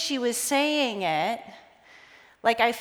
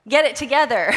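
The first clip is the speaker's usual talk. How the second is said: louder, blunt scolding; a friend's firm, louder rebuke; firm wake-up call; 3 semitones higher, 6 dB louder than usual. Retold or imitated. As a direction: snap her out of it. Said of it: "she had to sit me down and say".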